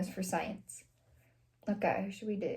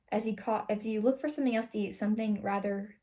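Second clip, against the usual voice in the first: usual speaking voice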